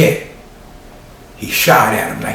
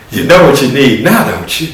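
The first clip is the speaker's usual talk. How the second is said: plain reading voice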